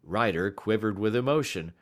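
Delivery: ordinary delivery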